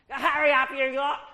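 put-on high, loud, barking at the kids; impatient shout. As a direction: hurry the children along impatiently. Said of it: "it comes to games time".

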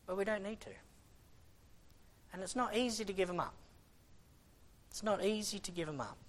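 soft, quick, breathy-sounding sigh into the words; sighed softly. Real, not acted. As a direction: let it out with a sigh, quietly. Sighing, soft.

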